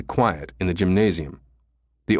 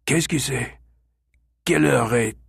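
put-on groggy old man; 5 semitones higher than usual. Old man woken, speaks French.

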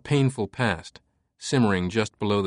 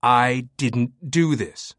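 slow, pleading denial; desperate plea; much slower than usual. Acted. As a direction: beg to be believed, slowly. Langdon pleading slowly.

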